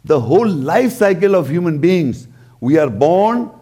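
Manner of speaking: ordinary delivery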